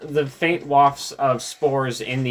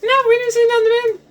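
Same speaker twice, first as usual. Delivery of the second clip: tiny squeaky creature voice; high-pitched mold voice; 4 dB louder, much faster than usual. Acted. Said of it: "mold has a very high-pitched, whiny voice".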